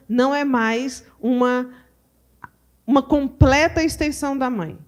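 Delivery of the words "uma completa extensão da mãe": normal talking voice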